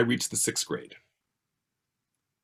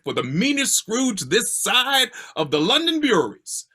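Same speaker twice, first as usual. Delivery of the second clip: lightly put-on British Cockney accent; a hammed-up touch of stage Cockney; 6 semitones higher than usual. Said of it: "giving my best British Cockney accent".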